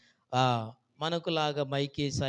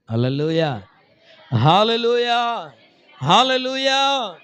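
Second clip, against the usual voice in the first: loud, high, drawn-out hallelujahs; hallelujah shout; 8 semitones higher, 12 dB louder, slower than usual. Real, not acted. Preacher calling the church to praise, shouting slowly. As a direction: cry it out to lift the congregation.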